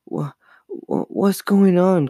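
high, slow, stammered question; frightened stammer. A narrator's reading. Chris backed against the wall in terror, frightened stammer. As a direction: stumble on the first word, scared and bewildered. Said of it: "Chris stammered".

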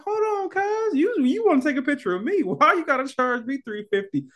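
very high, squeaky comic objection; incredulous mock protest. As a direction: voice leaps up in disbelief at the price. Acted protest at photographer's fee.

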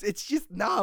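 usual speaking voice

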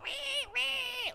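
very high, soft squealing wee; high piggy squeal wee; pig noises. Retold or imitated. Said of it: "weird pig noises".

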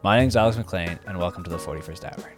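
upbeat radio host read; podcast intro. Acted. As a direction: introduce the show like an announcer.

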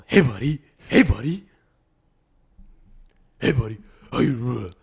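loud, gruff-casual dog greeting; bro greeting. Shown as a demonstration. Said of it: "pretend like he's your bro".

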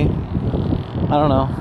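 slow, stoned drawl; drawn out like he's high; stoner. Real, not acted. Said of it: "I'm more quiet when I'm high".

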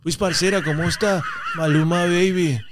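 imitated paisa Colombian accent. Comedian mocking how everyone imitates Colombians, paisa accent. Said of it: "si todos hablaran como [name]".

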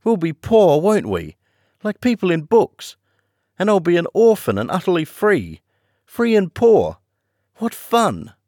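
put-on a girl thrilled by being poor and free; childish delight. A narrator's reading. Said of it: "in a sort of childish delight".

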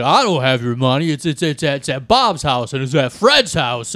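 a fair Jimmy Stewart, warmed up; Jimmy Stewart impression. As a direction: stutter it out like the old movie banker. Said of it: "That's better".

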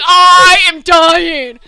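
slow, drawn-out mock despair; hammed-up overwhelmed groan; mock dying. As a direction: stretch it out like you can't take it. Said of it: "I'm like".

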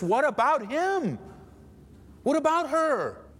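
high, drawn-out accusing question; indignant complaint. Acted. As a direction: stretch it out high, protesting to Jesus. Imagined complaint to Jesus.

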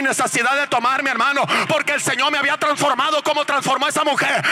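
high, shouted revival preaching; full-force preaching. Real, not acted. Preacher proclaims his transformation to congregation, full-force preaching. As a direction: build to ecstatic cries, stretching the climactic words.

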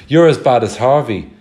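erupting. A narrator's reading. Lloyd erupting at the others in an angry outburst. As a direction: let it burst out at his friends. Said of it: "and Lloyd erupted".

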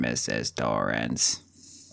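very low, drawn-out, soft character voice; deep, dragging impression; Tony voice. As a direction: stretch it slowly at the bottom of your range. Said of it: "I can't do the Tony voice".

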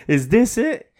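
high, frightened retelling of own thoughts; panicked inner voice. Retold or imitated. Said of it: "and you like".

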